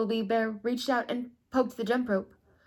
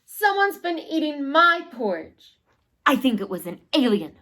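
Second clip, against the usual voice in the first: little bear voice; 6 semitones higher, 7 dB louder than usual. Acted. Little bear's line.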